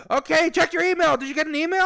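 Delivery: very high, loud demand; imitating an irate caller; screaming support guy. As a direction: yell the question impatiently. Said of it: "guy from DoorDash screamed at me".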